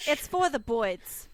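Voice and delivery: put-on slow, hammy Brooklyn accent; mock Brooklyn accent. Joke pronunciation of birds.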